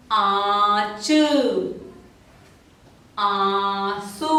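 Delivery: each word drawn out slowly with long pauses; slow read-aloud word list. Shown as a demonstration. Teacher reading nasal words slowly for children. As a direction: model every word slowly, nasal vowels held. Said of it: "और कुछ शब्द पढ़े".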